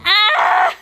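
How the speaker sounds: high tearful wail breaking into laughter; real cry-laugh burst; loud high cry. Real, not acted. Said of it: "cry again".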